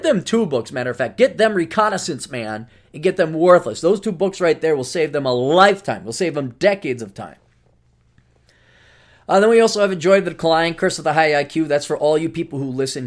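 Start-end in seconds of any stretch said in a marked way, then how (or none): none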